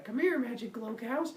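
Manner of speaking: high-pitched coaxing call; coaxing baby Hermes. Acted. Baby coaxes cows to follow.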